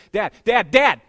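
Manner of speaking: loud, rising calls; kid calling for dad. Retold or imitated. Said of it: "they'd do this".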